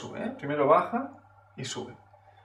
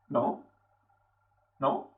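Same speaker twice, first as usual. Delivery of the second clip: soft "¿No?" rising sharply at the end; quiet questioning lift on "¿No?"; 4 semitones lower, 6 dB quieter than usual. Acted. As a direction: ask "¿No?" with the pitch sliding upward. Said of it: "le vamos a llamar tono pregunta".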